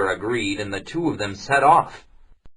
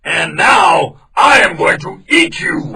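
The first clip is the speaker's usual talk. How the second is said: loud, slow, high threatening growl of a line; menacing tiger; 9 semitones higher, 9 dB louder than usual. Acted. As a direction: threaten with relish.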